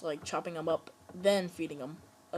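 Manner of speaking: ordinary delivery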